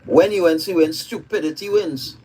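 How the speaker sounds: normal talking voice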